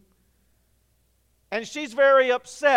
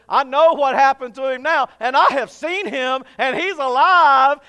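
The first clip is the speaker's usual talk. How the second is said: excited proclaiming; 3 semitones higher, 8 dB louder than usual. Acted. Mary proclaims to disciples.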